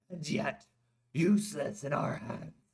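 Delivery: a hint of a stern warrior; Drog voice. A narrator's reading. Drog's voice in the story.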